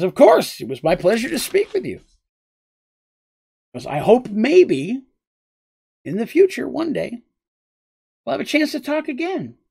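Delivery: a lanky squire hoping to meet the princess again, high and earnest; Ran, eager young knight. Acted. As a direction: lift the voice up and draw it out, hopeful and polite. GM voices Ran.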